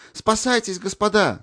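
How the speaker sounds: very high urgent cry of warning; alarmed shout. A narrator's reading. Cadet bursting into the aid post, alarmed shout. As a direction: raise the alarm, frantic. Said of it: "закричал он".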